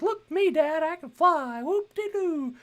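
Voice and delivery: put-on very high, loud gleeful boy voice; cocky Icarus. Icarus showing off to his dad in flight, mock cocky. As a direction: whoop it up in a loud, piping show-off voice. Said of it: "cocky and thinks he can do everything".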